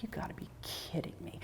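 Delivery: whispered, voiceless disbelief; disbelieving whisper. Retold or imitated. To herself after losing her son's necklace, whispered. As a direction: breathe it out in quiet shock.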